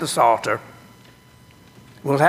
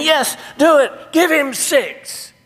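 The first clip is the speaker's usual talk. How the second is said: much higher, thrilled stadium cheer; jumping-up-and-down cheer; excited fan; 11 semitones higher than usual. Pretend. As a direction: cheer like a fan on his feet. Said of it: "you're jumping up and down and you're saying".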